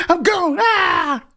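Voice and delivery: very high, slow, stretched-out cry; gleeful exit cry. Retold or imitated. Leaving the house behind for Atlanta, a very high stretched-out cry. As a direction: throw it out like you're finally free. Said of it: "I was like".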